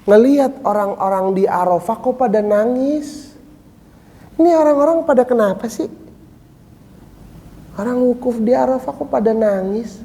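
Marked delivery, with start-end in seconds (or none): 4.4-10.0 high, fast, scoffing disbelief; puzzled scoffing. Retold or imitated. A pilgrim scoffing at others' tears, high and fast. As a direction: sneer at the people weeping around him. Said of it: "Kata dia".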